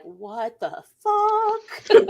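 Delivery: long, drawn-out shocked reaction; slow-motion retold astonishment; stunned disbelief. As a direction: stretch the words out very slowly, dumbfounded. Retells own stunned movie reaction.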